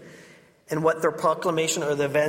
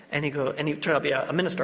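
ordinary talk, nothing marked

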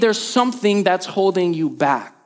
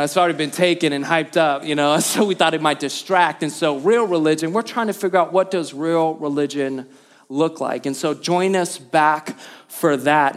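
normal talking voice

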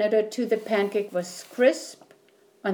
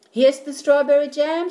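put-on loud, high boyish shout; 6 semitones higher, 6 dB louder than usual. A narrator's reading. Boy shouts to his mother with the egg, loud and high. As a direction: call it out, proud of the egg. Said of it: "shouted Jack".

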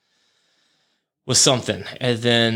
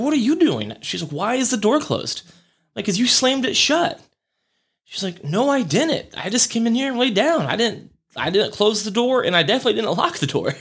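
put-on much higher, puzzled girlfriend voice; imitating girlfriend; 12 semitones higher than usual. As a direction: voice her surprised denial, pitched up. Girlfriend denies slamming the door.

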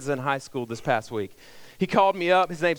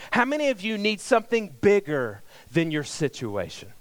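usual speaking voice

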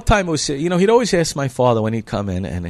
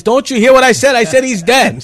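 much higher and louder, pressing it; insistent shout; 12 semitones higher, 11 dB louder than usual. Retold or imitated. [name] to the father, a loud insistent shout. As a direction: raise the voice to shut it down.

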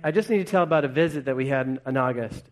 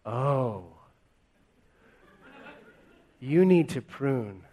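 lightly voiced kind landlord, slow; a hint of a polite neighbour's advice; landlord's comment; 2 semitones lower, slower than usual. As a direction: gently tell them to prune. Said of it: "their one comment was".

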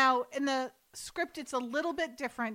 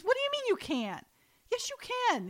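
high, insistent mom voice; high mother voice; 12 semitones higher than usual. Retold or imitated. Mother pressing her son, high and insistent. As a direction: pitch up as the pushy mother.

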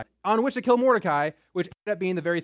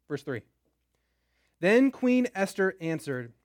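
usual speaking voice

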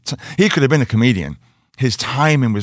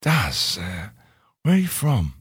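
soft imitation of a whisper in the ear; retold whisper; 3 semitones lower, 7 dB quieter than usual. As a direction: lean in with a hushed, confidential question. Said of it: "whispered in my ear".

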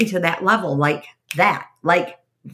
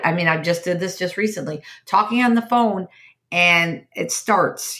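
ordinary talk, nothing marked

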